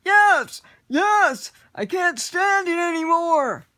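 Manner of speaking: very high, loud pleading shout; trapped goblin begging to be freed; desperate goblin. Acted. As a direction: beg at the top of your lungs. Trapped goblin begs release.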